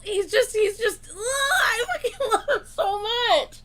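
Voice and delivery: high squeal of adoration; real fangirl squeal, high-pitched; overwhelmed gushing. Real, not acted. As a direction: burst out helplessly with affection, half laughing. Overwhelmed gushing over idol.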